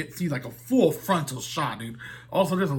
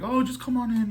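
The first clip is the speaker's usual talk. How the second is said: put-on girlfriend voice; 9 semitones higher than usual. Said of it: "the girl's like".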